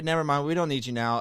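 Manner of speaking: usual speaking voice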